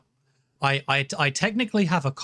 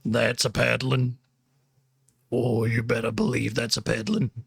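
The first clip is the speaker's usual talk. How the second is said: put-on quoting voice; 4 semitones lower than usual. Streamer quoting a paddling threat to chat, put-on low voice. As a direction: lower voice, lightly put on. Playful quote in a changed voice.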